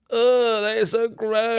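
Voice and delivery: high, drawn-out groan of mock disgust; imitated disgust. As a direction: mimic your partner being grossed out, stretching the words. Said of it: "she's like".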